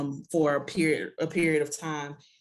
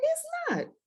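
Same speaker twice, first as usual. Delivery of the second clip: agreeing playfully. Retold or imitated. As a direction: chirp it back in a very high voice. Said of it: "I said".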